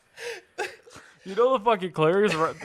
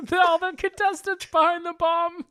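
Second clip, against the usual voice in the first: mock excited; 13 semitones higher, 5 dB louder than usual. Joking about marathon runners.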